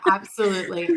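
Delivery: normal talking voice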